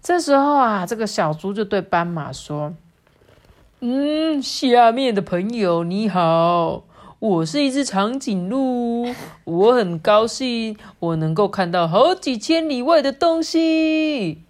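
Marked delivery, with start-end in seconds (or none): 3.8-14.4 slow, higher, drawn-out storybook animal voice; pig posing as giraffe, boastful. Acted. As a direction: greet grandly, stretching out the phrase endings. (小猪假扮长颈鹿，拖长音得意地炫耀)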